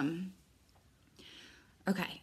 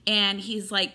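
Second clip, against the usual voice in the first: normal talking voice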